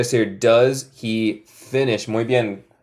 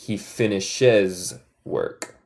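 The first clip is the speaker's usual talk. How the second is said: "He finishes work" at half speed; slow model sentence; 4 semitones lower, 4 dB quieter, much slower than usual. Shown as a demonstration. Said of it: "Él termina su trabajo".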